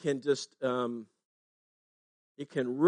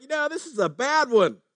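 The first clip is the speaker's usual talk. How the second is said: mock worried fishermen, pitched up high; 8 semitones higher than usual. Disciples in the storm telling each other it's bad, mildly worried. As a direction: act out a fisherman's worry as the boat takes in water. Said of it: "and they're like".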